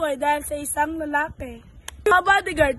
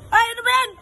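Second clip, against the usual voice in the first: high loud exasperated cry; exasperated shout; 6 semitones higher, 6 dB louder, much faster than usual. Acted. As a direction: throw up your hands, shouting. Host scolds again.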